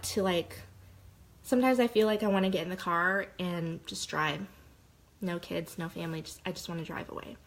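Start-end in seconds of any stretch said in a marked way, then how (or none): 5.2-7.4 fading to near silence, ending much softer; fading confession. Real, not acted. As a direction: let the words sink away into quiet. Confessing postpartum escape fantasy.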